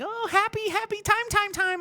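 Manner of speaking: very high sing-song; mock sing-song mayor. The mayor after dunking his son, high sing-song. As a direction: trill it like a fake-cheery mayor. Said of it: "then he's like".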